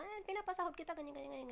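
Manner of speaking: a high, mildly mocking voice for the employer; imitating the boss. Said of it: "sasabihin nila". (ginagaya ang amo, mataas na boses)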